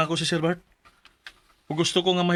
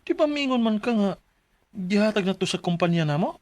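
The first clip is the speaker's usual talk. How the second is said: nervous pleading; 5 semitones higher than usual. Acted. Worker pleading with boss.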